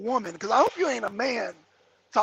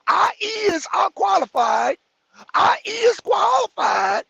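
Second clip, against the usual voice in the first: high shouted mock job applicant; 8 semitones higher, 4 dB louder, slower than usual. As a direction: yell the broken grammar like a proud applicant.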